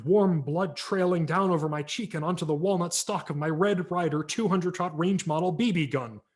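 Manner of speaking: ordinary delivery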